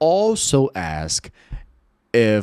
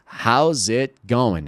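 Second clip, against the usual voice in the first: How's it going said very slowly, each word apart; slow demo; much slower than usual. Shown as a demonstration. Teacher slowly modeling the phrase for Portuguese learners. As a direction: spell it out slowly for students. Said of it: "Veja, nós dizemos".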